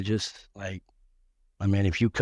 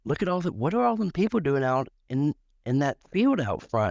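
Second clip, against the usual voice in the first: put-on higher, alarmed old-lady voice; confused grandmother; 6 semitones higher than usual. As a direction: peer out puzzled and worried. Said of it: "she'd be like".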